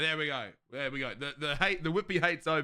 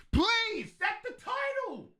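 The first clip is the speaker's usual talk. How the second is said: whiny plea; slower than usual. Real, not acted. Begging chat to set stream title.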